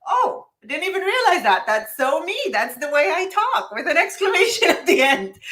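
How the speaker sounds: high, bright-eyed realization with a laugh; giggly high-pitched aha moment; excited self-quote. Retold or imitated. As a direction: replay your own delighted discovery, laughing. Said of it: "And I was like".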